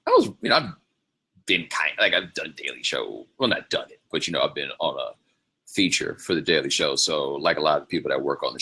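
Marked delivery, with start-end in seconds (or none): none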